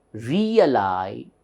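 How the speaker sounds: "Realization" with a long, strong "za"; teacher's split demo, "Reali-ZAY-tion"; stress on ZAY. Shown as a demonstration. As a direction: lean hard on "za" in "Realization". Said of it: "upper stress for this syllable".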